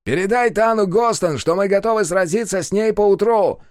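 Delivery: loud defiant shout. Acted. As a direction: call it out across the water. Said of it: "крикнул Пума Ему".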